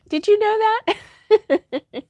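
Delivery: high, slow question through laughter; laughing. Real, not acted. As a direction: giggle it out while asking. Laughing confession to chat.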